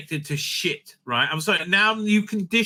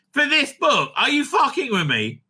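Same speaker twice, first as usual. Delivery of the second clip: ordinary talk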